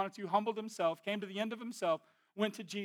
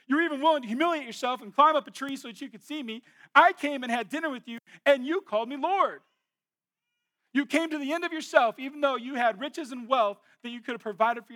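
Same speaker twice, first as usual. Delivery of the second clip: higher and louder, pressing the point home; preaching at full force; 5 semitones higher, 7 dB louder than usual. Acted. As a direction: preach it to the crowd, voice raised. Preacher voicing Jesus, building.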